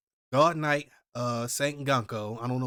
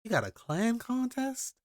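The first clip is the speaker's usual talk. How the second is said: incredulous; 10 semitones higher than usual. Real, not acted. Amazed at the competition.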